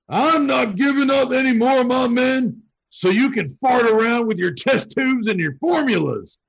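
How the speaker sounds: high, loud angry scream. Acted. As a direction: scream it at the doctor. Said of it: "the cigar-chomping metalhead screams at the doctor".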